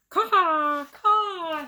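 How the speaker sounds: high, cawing like a crow; imitated crow cawing from a branch. A narrator's reading. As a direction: caw it out like the bird. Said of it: "he said".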